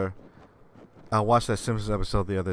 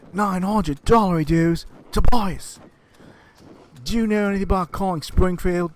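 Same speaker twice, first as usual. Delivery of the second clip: angry father; 10 semitones higher, 6 dB louder than usual. Retold or imitated. Father scolding his son about the phone bill, higher scolding voice.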